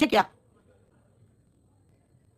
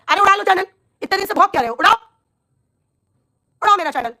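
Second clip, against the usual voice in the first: defiant dare; 6 semitones higher than usual. Real, not acted. Daring the troll to delete her channel, defiant. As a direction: throw the dare right at him.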